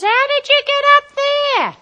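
high, loud shout across a distance; high-pitched calling out; yelled up from below. Acted. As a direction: holler it up to someone far above. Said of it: "So I give him a little yell".

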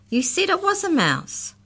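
high-pitched angry accusing; angry accusation. A narrator's reading. Molly turns on Mallory, angry and accusing. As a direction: accuse angrily, voice shooting up high. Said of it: "Molly turned angrily to Mallory".

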